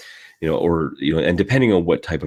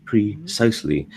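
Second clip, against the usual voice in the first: precisely dictated RP syllables; Queen's English, each syllable set apart; received pronunciation; 3 semitones higher than usual. Shown as a demonstration. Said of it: "is precisely, and I do mean precisely".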